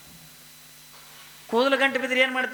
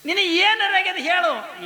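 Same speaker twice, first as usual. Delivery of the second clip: concerned mother; 5 semitones higher than usual. Retold or imitated. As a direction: coax the son, then demand an answer. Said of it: "ಅವ್ರು ಅಂದು". (ಮಗನನ್ನು ಕೇಳುವ ತಾಯಿ, ಎತ್ತರದ ಧ್ವನಿಯಲ್ಲಿ)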